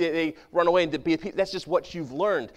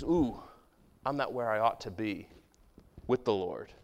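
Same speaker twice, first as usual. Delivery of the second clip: quiet confession; 6 semitones lower, 7 dB quieter than usual. Pretend. Believer admitting to God he falls short, soft and low. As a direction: lower and softer, a dropping inward sigh.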